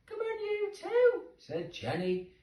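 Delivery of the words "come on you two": put-on high, loud call of a little girl. Jenny calls the kittens, high girlish call. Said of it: "called to them".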